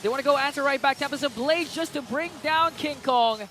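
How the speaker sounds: excited shoutcaster in full flow; live play-by-play. Real, not acted. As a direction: call the fight fast and high as it happens.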